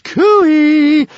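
loud, very high, long-held coo-ee call; excited squeal. A narrator's reading. Stormfly hails the approaching dragons, squealing excitedly. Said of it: "squealed Stormfly in excitement".

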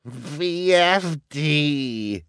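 slow, high, loud mocking letters; cackling. A narrator's reading. Villainess mocks the initials to her gang, cackling. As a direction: stretch the letters gleefully. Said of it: "Esme said with a cackle".

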